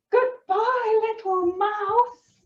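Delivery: high, slow mock-frightened farewell; storybook alarm. A narrator's reading. Snake flees from the Gruffalo, high acted alarm. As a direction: pitch it right up as a scared animal leaving.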